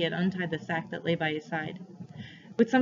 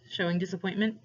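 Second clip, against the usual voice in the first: usual speaking voice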